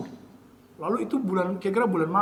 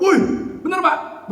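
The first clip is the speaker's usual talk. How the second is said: excited disbelief; 8 semitones higher, 5 dB louder than usual. Retold or imitated. Retold good news from second doctor.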